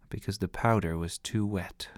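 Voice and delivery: ordinary delivery, nothing marked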